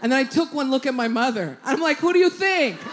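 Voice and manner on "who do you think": high, loud cocky reply; triumphant shout. Retold or imitated. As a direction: fire back proudly. Pointing to mother, shouting.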